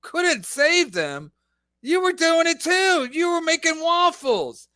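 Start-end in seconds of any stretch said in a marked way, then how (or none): 0.0-1.3 slow, higher sneering repeat; mocking echo. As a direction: drawl it back through a laugh.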